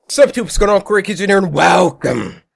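high, loud energetic greeting; hype intro. Acted. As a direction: pump up the channel intro.

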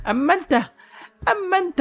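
very high, hammed-up lead-in; exaggerated high-pitched build-up; mock-dramatic address. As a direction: squeal it out, pausing for effect. Hero turns scornfully on villain.

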